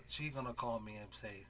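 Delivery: ordinary talk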